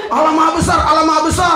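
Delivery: mock call to prayer. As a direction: imitate the shouting from the mosque. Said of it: "ada yang teriak-teriak".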